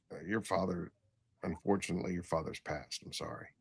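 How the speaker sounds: very soft, low voice, sinking; subdued condolence. Retold or imitated. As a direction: break the bad news quietly and low. Breaking news of a death.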